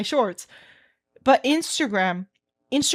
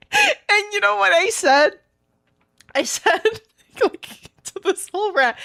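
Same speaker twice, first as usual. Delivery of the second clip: laughing; 7 semitones higher than usual. Real, not acted. Still laughing at the rant.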